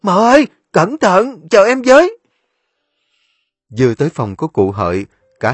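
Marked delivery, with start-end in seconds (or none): 0.0-2.2 high, loud call to someone ahead; calling after. A narrator's reading. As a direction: shout after her anxiously. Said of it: "gọi dối theo mợ".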